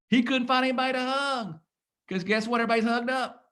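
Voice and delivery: high, swelling excitement in the telling; emotional high-pitched retelling; excited. Real, not acted. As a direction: let the story lift you up high. Excited retelling of Valvano's run.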